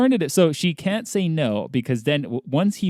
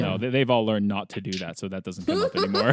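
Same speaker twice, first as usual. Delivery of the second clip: ordinary delivery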